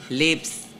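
Lips with her own thick accent. Shown as a demonstration. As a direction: say Lips in a heavy foreign accent. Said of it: "I have a thick accent".

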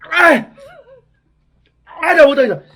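loud high begging cries; can't take the burn anymore; desperate pained yelling. Real, not acted. Begging to quit from the burning.

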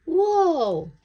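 high, loud startled cry; bunny jolted by a scare; startled whoa. A narrator's reading. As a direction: gasp it out in shock. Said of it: "said P J".

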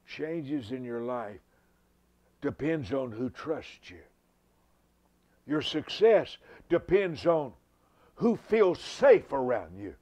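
slow, loud, rising sermon climax; preaching at full force. Real, not acted.

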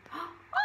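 storyteller gasping at mouse's trick; surprised gasp. Acted. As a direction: gasp in wonder for the children. Surprised gasp at tail catching.

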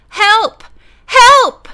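loud, high cry, rising on the second; scream for help. A narrator's reading. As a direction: scream desperately for rescue. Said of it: "she screamed".

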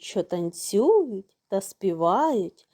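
ordinary delivery, nothing marked

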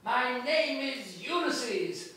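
a touch of a taunting shout, high and slow; mild mock shout. Ulysses taunting the Cyclops from the boat, mild mock shout. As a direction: call it out like a taunt. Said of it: "he shouts out".

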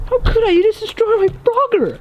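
high, outraged accusation; indignant comeback. Real, not acted. Blames the other for destroyed Frogger, indignant. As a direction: fire back with pitch jumping up.

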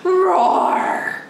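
loud, drawn-out roar; tiger roar. Shown as a demonstration. Showing children tiger hello, loud roar. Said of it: "this is what means hello in tiger".

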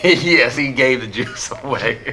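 talking through real laughter; laughing agreement. Real, not acted. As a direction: agree while cracking up. Laughing at co-host's joke.

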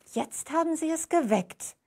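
reproachful. A narrator's reading. Alma Luise to the nurse, reproachful. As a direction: scold him for waking the baby. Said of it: "sagte Alma Luise vorwurfsvoll".

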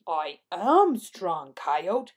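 slow, loud puffed-up boast; proud Bighorn Sheep. A narrator's reading. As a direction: declare it slowly with a swollen chest. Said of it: "puffing out his mighty chest".

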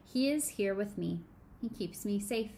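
normal talking voice

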